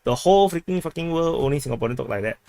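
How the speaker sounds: ordinary talk, nothing marked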